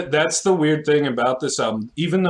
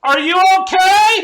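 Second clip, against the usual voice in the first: concerned shout; 7 dB louder than usual. Retold or imitated. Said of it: "And I'm like".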